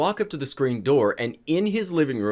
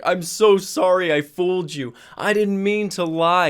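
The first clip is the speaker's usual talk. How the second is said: put-on drunk apologetic dad; 5 semitones higher, 3 dB louder than usual. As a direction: whine out a wasted father's apology. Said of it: "But the dude was wasted".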